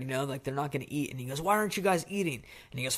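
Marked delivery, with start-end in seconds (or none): 1.4-2.4 acted exasperated demand, pitched up; mild mock-angry questioning; intimidating dad. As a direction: scold lightly in a raised pitch, as a retold father. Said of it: "'cause they're so scared".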